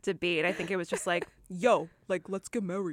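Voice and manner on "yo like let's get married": put-on Brooklyn accent. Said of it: "like, Brooklyn voice".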